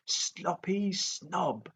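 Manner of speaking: high, slow sing-song jeer; jeering dragon. A narrator's reading. Toothless repeats the taunt at Fireworm, slow sing-song jeer. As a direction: drawl out the jeer. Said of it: "jeered Toothless".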